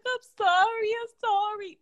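high, pleading imitation of a crying boy; mock tearful apology. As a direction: plead it like a sorry little brother. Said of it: "he would just start crying".

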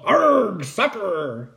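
high growled 'arg', then falling; pirate yell. A narrator's reading. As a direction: yell it as the captain kicks. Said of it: "kicked the ball and yelled".

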